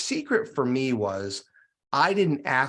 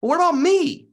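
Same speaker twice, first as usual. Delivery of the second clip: eager offer; 7 dB louder than usual. Retold or imitated. Friend offers himself, punchline.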